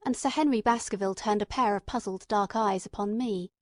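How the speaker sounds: ordinary delivery, nothing marked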